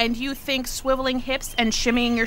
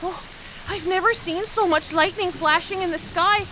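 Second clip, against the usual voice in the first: ordinary talk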